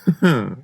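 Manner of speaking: short, mad little laugh; gleeful chuckle. A narrator's reading. Killer laughs at how well he hid the blood. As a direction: chuckle at your own cleverness. Gloating over hiding the body.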